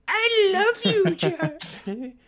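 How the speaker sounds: very high, slow put-on cry of devotion; hammed-up adoration. Man declaring love to his doll, hammed-up high wail. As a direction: wail love in a squealing mock voice.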